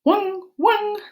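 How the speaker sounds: high, bright barking wang wang; Japanese dog bark. Shown as a demonstration. Teacher barking the Japanese dog sound. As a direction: yap it like a puppy. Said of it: "in Japanese, it's".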